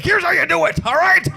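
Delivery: put-on impatient old man. Old YouTube dad teaching viewers, loud high impatient yell. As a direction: bark at the viewer to hurry up. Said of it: "He's just like".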